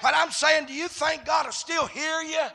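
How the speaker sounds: loud, driving preacher's cadence; full-force preaching. Real, not acted. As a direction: preach it in punched, shouted phrases.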